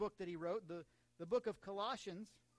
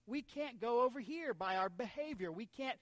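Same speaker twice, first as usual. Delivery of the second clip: slow, forceful pulpit delivery; emphatic preaching; 4 semitones higher, 6 dB louder than usual. Real, not acted. Preacher telling church nobody can earn it, emphatic preaching. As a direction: hammer each key word to the congregation. Preacher drives home his point.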